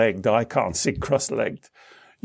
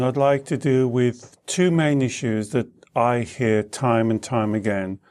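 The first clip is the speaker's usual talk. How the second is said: usual speaking voice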